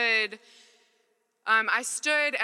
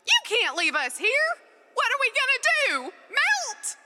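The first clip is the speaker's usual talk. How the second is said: much higher, louder put-on mom voice; mom's voice; 4 dB louder than usual. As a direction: squeal it like a frantic wax mom. Mom voiced in dream story.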